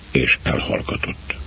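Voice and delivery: plain reading voice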